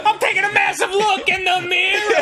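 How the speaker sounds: high, strained comic shout; desperate cover-up yelled through the door; super frantic. Acted. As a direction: blurt a bad excuse in panic. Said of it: "Super frantic".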